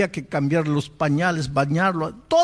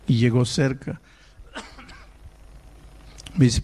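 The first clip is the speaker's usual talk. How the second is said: normal talking voice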